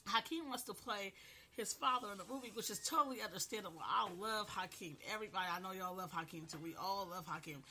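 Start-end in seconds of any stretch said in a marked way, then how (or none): none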